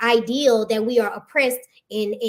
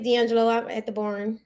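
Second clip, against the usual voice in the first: ordinary talk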